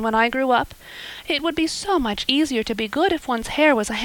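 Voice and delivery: put-on a girl thanking her new benefactor, eager; eager young Anne. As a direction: gush with hope like an imaginative girl. Anne voiced in story.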